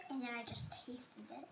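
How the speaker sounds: breathy whisper. Real, not acted. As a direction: whisper it, grossed out. Whispering after bad bean.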